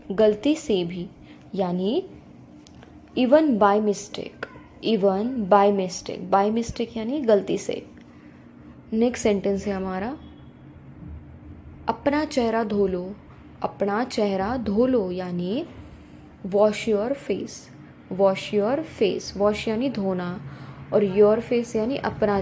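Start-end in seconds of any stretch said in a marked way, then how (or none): 3.2-6.3 slow model reading. Shown as a demonstration. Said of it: "teacher models the sentence slowly". 16.5-19.3 slow model reading. Shown as a demonstration. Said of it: "teacher models the sentence slowly".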